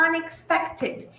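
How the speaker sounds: Unexpected, said slowly and softly; teacher repeating Unexpected slowly; slow model word. Shown as a demonstration. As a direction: draw out Unexpected for learners. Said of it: "Y el otro ejemplo es".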